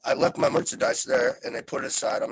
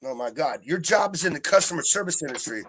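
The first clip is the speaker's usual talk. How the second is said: usual speaking voice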